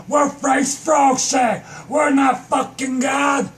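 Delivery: high-pitched, exasperated hollering; worked-up comic shouting; indignant rant. Acted. Indignant rant about frozen frogs.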